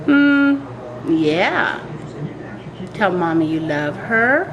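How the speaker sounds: ordinary delivery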